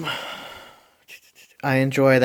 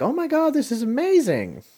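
much higher, gushing with delight; amazed extras; 12 semitones higher than usual. Retold or imitated. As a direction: squeal it like thrilled onlookers. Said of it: "They're like".

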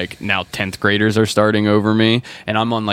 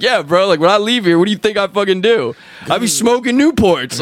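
cocky admission; 10 semitones higher, 4 dB louder than usual. Retold or imitated. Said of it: "And I was like".

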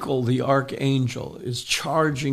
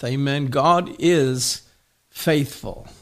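normal talking voice

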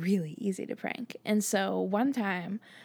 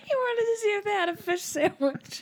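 laughing; 12 semitones higher than usual. Real, not acted. Laughing while teasing.

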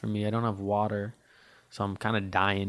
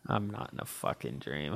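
uneasy quiet; 6 semitones lower, 10 dB quieter than usual. Real, not acted. Asking listeners if he is dreaming, quiet unease. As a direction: lower and much softer, unsure.

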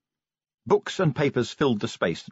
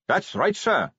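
put-on higher eager young Carrot voice; 8 semitones higher than usual.

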